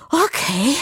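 high, winded gasp. A narrator's reading. Cécile stops running, hands on knees, gasping. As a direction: pant it out after a run. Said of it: "keuchte sie".

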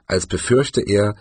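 usual speaking voice

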